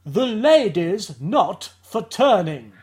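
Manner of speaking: Thatcher impression. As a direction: drawl it out imperiously. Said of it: "who's this?".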